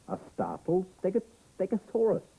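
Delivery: very high, surprised narration; startled story reading. A narrator's reading. Reader reveals the dinosaur under the flap, startled and high. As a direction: jump up in pitch as if startled.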